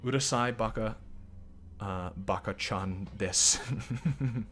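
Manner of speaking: Japanese accent. Shown as a demonstration. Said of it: "I can't even do a Japanese accent".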